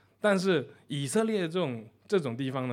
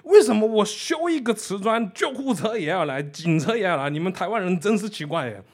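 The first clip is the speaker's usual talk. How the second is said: louder, higher, baffled complaint; perplexed foreigner; puzzled; 5 semitones higher, 6 dB louder than usual. Retold or imitated. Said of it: "德国人很纳闷".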